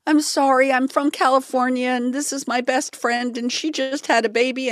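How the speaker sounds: high, flustered apologetic rush; high apologetic pleading. Retold or imitated. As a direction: plead sweetly, all in a rush. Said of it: "I looked up at this woman like".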